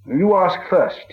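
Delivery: high, slow nervous offer; buy time with a slow high reply; hesitant hobbit; hobbit stalling. A narrator's reading.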